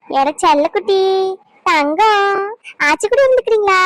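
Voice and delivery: sweeten the voice for the little one; baby talk. Acted. Grandmother cooing at baby.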